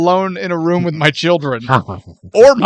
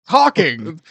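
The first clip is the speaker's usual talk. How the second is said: normal talking voice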